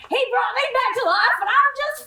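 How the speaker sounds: sarcastic shout. Shown as a demonstration. Sally pitches a sarcastic line to the table, shouting. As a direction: yell that you're just fine, clearly not. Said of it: "can I interject and say".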